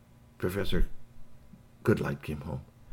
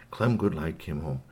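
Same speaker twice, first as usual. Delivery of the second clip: ordinary delivery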